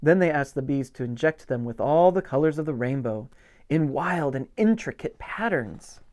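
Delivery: ordinary delivery, nothing marked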